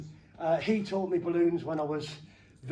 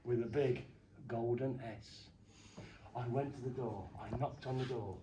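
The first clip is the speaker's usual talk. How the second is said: hushed suspense; 7 semitones lower, 10 dB quieter than usual. Acted. Telling the kids a spooky story, low and hushed. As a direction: draw the crowd in with a hush.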